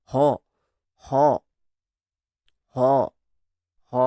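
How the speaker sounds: হ said with a tightened throat, a squeezed breathy h; repeated constricted h demo. Shown as a demonstration. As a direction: squeeze the throat on each হ like a deflating balloon. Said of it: "দেখো, feel করো".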